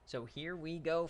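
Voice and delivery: normal talking voice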